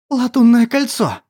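sudden startled cry; shocked gasp. Acted. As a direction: blurt it out in shock. Shocked exclamation.

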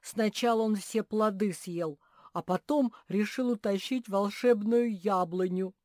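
loud, high, heated complaint; indignant accusation. A narrator's reading. Bear cub complains to the owl, heated yelling. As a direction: pile on the accusation loudly.

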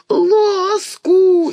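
put-on high, loud toddler wail; howling. A narrator's reading. Toddler howls over her dropped spoon, high and loud. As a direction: bawl like a furious baby. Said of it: "истошно взревела Марьяшка".

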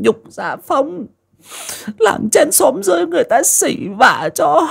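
put-on high, tearful old woman's plea; sobbing lament. A narrator's reading. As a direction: weep to the late husband's portrait. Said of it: "thắp lên một tuần nhang mà nức nở".

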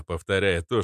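usual speaking voice

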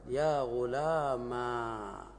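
very slow يا غلاما with a long final a; final step demo. Shown as a demonstration. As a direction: stretch out يا غلاما at the end of the chain. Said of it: "أولًا". (يا غلاما بمدّ وبطء)

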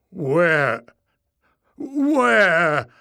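loud, drawn-out gaping stammer; stuttering astonishment. A narrator's reading. Warren gapes like a fish, stuttering loudly. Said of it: "he stuttered".

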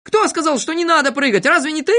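high, heated questioning; indignant crowd voice; accusing shout. A narrator's reading. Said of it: "закричали тут все".

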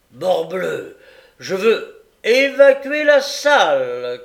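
very loud, high, slow furious shout; shouting magistrate. Acted. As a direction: roar the order. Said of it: "cria le magistrat".

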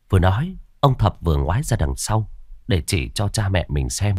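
usual speaking voice

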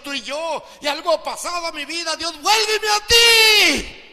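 a repentant believer crying out to God; pleading prayer. Acted. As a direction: beg God to take you back. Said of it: "clames y gimas a Dios y dile".

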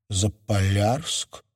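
slow, pensive question; drawn out and musing; thoughtful. A narrator's reading. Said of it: "задумчиво произнесла Кира".

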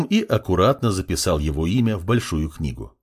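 ordinary delivery, nothing marked

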